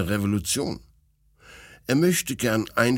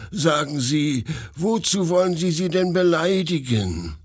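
lightly voiced pleading retainer; a touch of wounded appeal; reproachful servant; 7 semitones higher, 3 dB louder than usual. A narrator's reading. Mark appealing to the prince's feelings, mildly reproachful.

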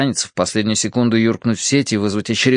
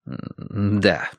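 softer, subdued servant's answer; quiet reply; 3 semitones lower, 5 dB quieter than usual. A narrator's reading. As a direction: answer softly, trailing into breath. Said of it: "ответил Бэйн тихо". (тихий покорный ответ)

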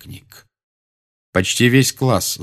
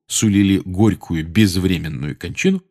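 ordinary delivery, nothing marked

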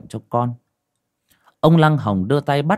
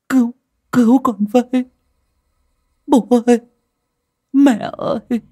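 high, louder frightened pleas; terrified begging; 10 semitones higher, 5 dB louder than usual. A narrator's reading. Possessed boy begs his parents to save him, high and frightened. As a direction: cry out for help in terror. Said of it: "như van xin".

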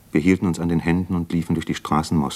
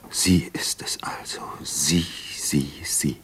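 whisper; 2 semitones lower, 4 dB quieter, slower than usual. Acted. Stunned recognition, inner voice.

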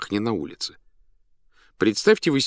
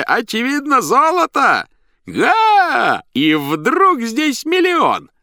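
booming circus ringmaster; 7 dB louder, slower than usual. A narrator's reading. Clown performing for circus crowd.

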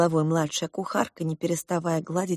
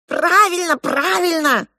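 high, raised squawking cry; parrots yelling; 12 semitones higher, 5 dB louder, slower than usual. A narrator's reading. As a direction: yell it out like two parrots. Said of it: "заорали два". (орущие попугаи)

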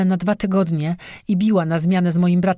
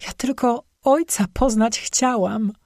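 slow, higher, tearful plea; through tears; 6 semitones higher than usual. A narrator's reading. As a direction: speak it while swallowing back tears. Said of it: "łykając kolejne łzy". (powoli i wyżej, przez łzy)

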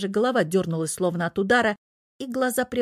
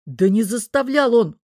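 loud, higher-pitched denial; emphatic, raised protest; exasperated explaining; 4 semitones higher, 7 dB louder than usual. A narrator's reading. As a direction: insist against the accusation. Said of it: "объяснял чёрт".

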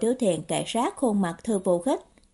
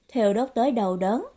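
ordinary delivery, nothing marked

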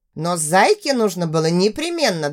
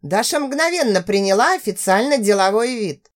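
ordinary delivery, nothing marked

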